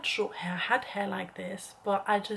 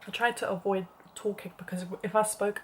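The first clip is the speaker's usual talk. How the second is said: usual speaking voice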